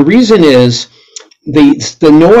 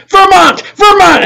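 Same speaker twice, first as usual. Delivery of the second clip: shouting Vermont. Retold or imitated. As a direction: call it out over the crowded band. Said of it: "I just yell".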